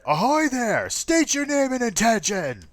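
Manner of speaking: high, loud hail across the water through a leather cone; officer's hail. Acted. Harbor officer hails the pirate ship, loud and high.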